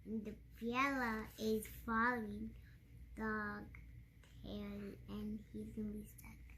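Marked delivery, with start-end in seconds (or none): 3.2-6.4 whisper. Real, not acted. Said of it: "child drops to whisper".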